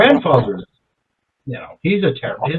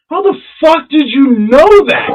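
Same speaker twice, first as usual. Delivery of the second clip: high, loud astonished outburst; amazed disbelief; 11 semitones higher, 5 dB louder than usual. Retold or imitated. Customer retells asking the bartender how she knew, amazed. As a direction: blurt it out high, floored by her guess.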